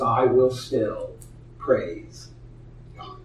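slow, soft and low, almost spent; a broken man quietly insisting he will still praise God; weary whispered faith. Retold or imitated. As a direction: hold on to faith with the last breath. Job's final weary affirmation.

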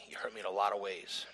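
breathy whisper, barely voiced, falling at the end; whispered hurt. Speaking to his father, a hushed, wounded whisper. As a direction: let the hurt slip out in a whisper.

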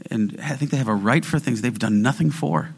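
usual speaking voice

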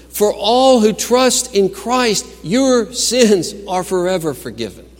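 high, forceful sermon proclamation; preach. Acted. Preacher declaring forgiveness to the congregation, high impassioned preaching. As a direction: declare it with big emphatic peaks.

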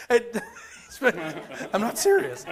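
said through a laugh. Real, not acted. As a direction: laugh off the mock threat, pitch slightly lifted. Joking aside after mock threat.

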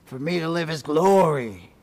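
boastful soldier declaring glory; proud soldier. Acted. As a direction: announce it like a proud legionary. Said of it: "clothed in chainmail and says".

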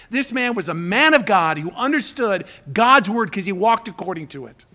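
ordinary talk, nothing marked

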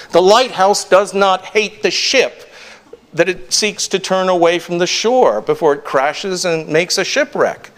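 ordinary delivery

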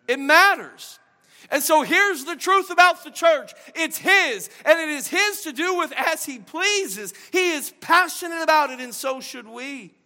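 high, loud, rising passion; preaching climax. Real, not acted. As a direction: pour it out at full force.

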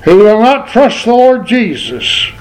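ordinary talk, nothing marked

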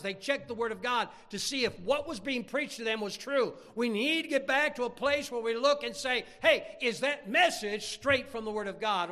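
insistent, punchy pulpit voice; fervent preaching. Real, not acted. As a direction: drive the point home to the congregation. Preaches with rising force.

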